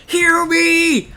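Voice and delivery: high, loud whining demand for healing; badly imitated bellow in a weird accent; Metro. Streamer mocking Metro bitching at his support, high loud yell. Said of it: "I can't do his, like, weird ass accent".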